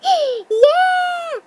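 high, loud drawn-out yell; excited cheer. Pretend. Vlogger cheering a pixelated camera effect, high excited yell.

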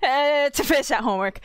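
playfully overeager read; mock eager. Shown as a demonstration. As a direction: ham up the eagerness about homework. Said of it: "laughs, rereads line".

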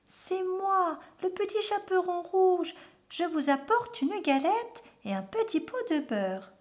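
very high put-on little-girl voice; imitated little girl. A narrator's reading. Wolf fooling the grandmother, imitating a little girl. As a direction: fake a small girl's high voice. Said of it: "Imitant la voix de petite fille".

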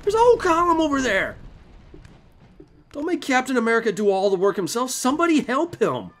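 high, loud indignant shouting; exasperated yelling. Real, not acted. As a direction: yell orders at useless troops, high and loud. Yelling at idle game soldiers.

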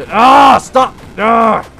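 furious yelp then shouted stop; raging shout. Real, not acted. Player raging at the boss that hits him, angry shout. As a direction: blow up at the screen.

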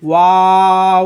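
ওয়াও stretched very slowly through nearly closed lips; long closed-mouth waw. Shown as a demonstration. Teacher modelling the long ওয়াও, lips closed. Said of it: "মুখটাকে বন্ধ করে বড় করতে হবে".

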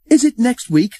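high, loud eager question. A narrator's reading. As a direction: ask it with bursting impatience. Twins asking eagerly.

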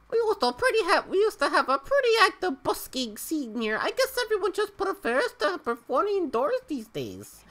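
high-pitched Malaysian accent voice. Shown as a demonstration. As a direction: read it like a Malaysian NPC. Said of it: "That's my best Malaysian accent".

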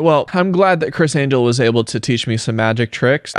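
ordinary talk, nothing marked

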